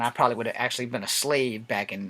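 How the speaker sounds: ordinary talk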